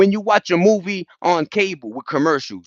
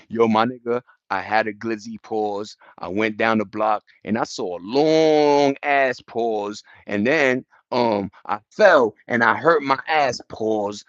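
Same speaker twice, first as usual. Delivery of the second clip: mock pause-sayer; 7 semitones lower, slower than usual. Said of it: "I'll be like".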